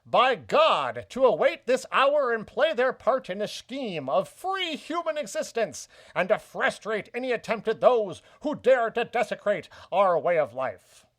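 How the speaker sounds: put-on grand old newsreel mid-Atlantic accent. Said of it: "I'll go back into the accent here".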